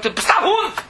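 high angry outburst calling him a dog; mock angry cry. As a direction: hurl the insult in a high voice. Angry man in parable.

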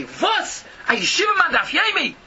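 a rabbi's indignant retold yell. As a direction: bark it like a rabbi scolding. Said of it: "But did he yell at me".

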